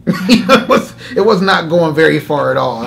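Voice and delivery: talking through laughter; chuckling while telling it; real laughter at his own weak prayer; laughing. Real, not acted.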